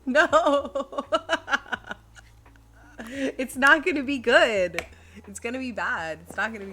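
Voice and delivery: high, loud, laughing refusal; laughing protest. Real, not acted. Streamer begged by chat to do an accent, loud laughing protest. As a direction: protest loudly through laughter, drawing it out.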